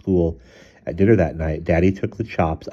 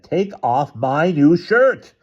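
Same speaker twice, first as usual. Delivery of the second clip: loud, high, drawn-out demand; angry order; 10 semitones higher, 5 dB louder, much slower than usual. A narrator's reading. Husband quarrelling with wife over a shirt, angrily demanding. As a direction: punch out each word slowly and loudly.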